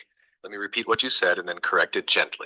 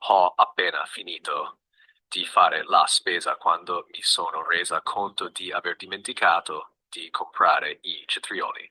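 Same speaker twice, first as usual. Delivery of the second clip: slow, careful dictation pace; unhurried teacher dictation; nice and slow. Shown as a demonstration. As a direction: spell it out slowly so it can be written down. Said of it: "Here's the sentence nice and slow".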